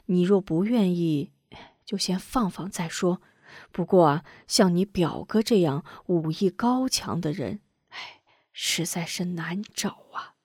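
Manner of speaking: soft, hushed comforting; calm the crying daughter quietly; soothing father. Acted. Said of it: "张雄见女儿流泪".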